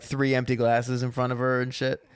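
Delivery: ordinary delivery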